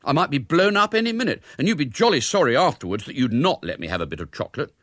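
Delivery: put-upon schoolboy pleading; aggrieved wheedling. A narrator's reading. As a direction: build a self-pitying case like a child. Boy wheedles mother for chocolate.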